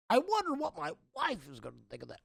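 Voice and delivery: soft, quick mock musing; Ned the wife guy. YouTuber imitating the guy's many wife moments, softly mocking husband voice. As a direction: lightly mimic a wife-obsessed husband, hushed and hurried. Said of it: "hundreds of moments where he's like".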